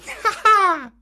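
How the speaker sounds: very high, short giggle; mocking boyish laugh. Boy laughing at the snake's nose, high giggle.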